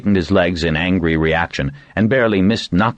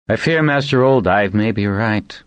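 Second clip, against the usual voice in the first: ordinary delivery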